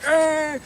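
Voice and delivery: high, loud engine whine; imitated engine revving. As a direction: whine like a car flooring it. Imitating the accelerating car.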